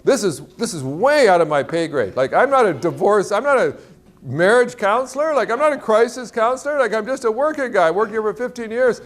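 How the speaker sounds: high-pitched, flustered inner protest; mock panic. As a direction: play a man panicking, pitched well up. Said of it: "he's just panicking".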